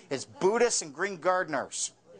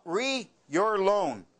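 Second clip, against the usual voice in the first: slow emphatic read; much slower than usual. Shown as a demonstration. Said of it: "Right at the top".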